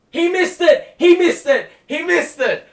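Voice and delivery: high, loud, repeated cry; real gleeful shouting; excited yelling. Real, not acted. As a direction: crow that he missed. Rival misses free throw.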